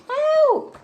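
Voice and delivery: high, loud squeal for help; cry for help. A narrator's reading. The frightened sister crying out at the water monsters, high and loud. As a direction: shriek it in panic. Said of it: "And the other one cried".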